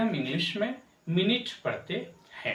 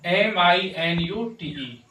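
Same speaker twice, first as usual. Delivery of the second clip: slow, louder letter spelling; spelled out letter by letter; 4 dB louder, slower than usual. Shown as a demonstration. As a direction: spell each letter out clearly. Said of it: "इसे हम क्या पढ़ते हैं?".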